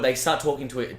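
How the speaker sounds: usual speaking voice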